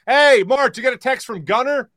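imitated yell across the house; yelling from another room. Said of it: "my buddy yells out from the other room".

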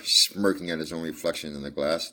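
plain reading voice

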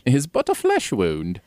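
lightly put-on British accent; quoting Monty Python with a British accent. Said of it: "We did our British accents for you".

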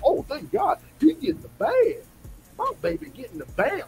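high, sing-song fan voice, mocking; doting fan cooing over his fighter; mocking gushing fan. Mocking fans cooing that their fighter gets paid. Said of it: "They were like".

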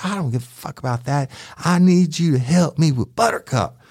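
high-pitched imitation of an angry, cursing woman; Miss Mary imitated. Deputy retelling the woman yelling back at him, high mock-angry voice. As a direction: squeak her up high, all attitude.

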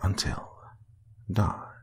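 itchy, breathless whisper; a creepy voice whispering in his head; awful whisper. A narrator's reading. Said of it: "awful whisper of the voice".

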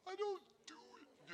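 high tiny whisper; Bobby's squeaky whispered denial. Retold or imitated. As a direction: whisper it like a timid little man.

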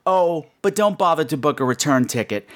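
ordinary talk, nothing marked

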